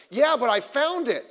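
indignant imitation of a child arguing back; child's protest. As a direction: protest like a kid caught out. Voicing a child protesting to parent.

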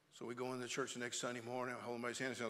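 ordinary talk

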